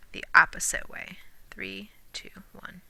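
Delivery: whispered counting. Shown as a demonstration. Teacher whispers the backwards count to the class. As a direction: breathe it out unvoiced, like a secret. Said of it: "whispered aside".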